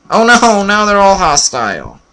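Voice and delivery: high, wailing mock "oh no"; mock dismay. Player sees every villager turn hostile, mock dismay. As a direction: lament the disaster with hammed-up alarm.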